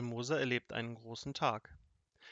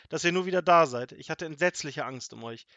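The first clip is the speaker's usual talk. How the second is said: loud, higher, emotional grandmother; joyful outpouring, voice raised; overjoyed relief; 4 semitones higher, 8 dB louder than usual. A narrator's reading. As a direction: gush with relief and disbelief. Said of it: "Sie lachte und weinte gleichzeitig".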